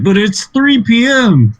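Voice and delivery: high, slow mock objection; a put-on squeaky protest. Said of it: "It's like".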